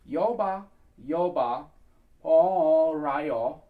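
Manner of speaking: "Yoba, Yoba, Paul Rayo" in loud separated chunks; pronunciation demo. Shown as a demonstration. Coach teaching the next bit to singers, carefully pronounced. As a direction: model "Yoba, Yoba, Paul Rayo" deliberately, drawing out "Paul". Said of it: "the next bit here is".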